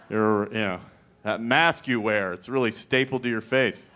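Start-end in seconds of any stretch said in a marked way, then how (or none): none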